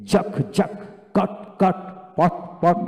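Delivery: teacher's jerky word drill, giving জাক, কট, পট a sharp push each; jolting drill delivery. Shown as a demonstration. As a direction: jolt out each জাক, কট, পট, twice over with short gaps. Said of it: "এইভাবে জাকি দিয়ে দিয়ে তাকে পড়াবেন".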